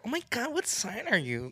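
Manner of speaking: high, put-on girl voice; mock girlish chirp; girly voice. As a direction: mimic a gushing girl. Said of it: "I sound like a fucking girl".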